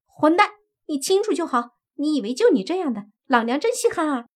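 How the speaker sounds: high, shrieking insult, fast in the middle; angry scolding. Acted. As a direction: spit scorn at him. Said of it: "青衣狐妖也骂道".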